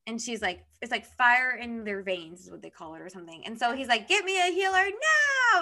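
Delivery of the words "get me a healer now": high, loud, slow desperate order; retold yell. Prince shouting for help as the girl is poisoned, urgent retold yell. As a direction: bark for a healer as if she's dying. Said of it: "yelling at her".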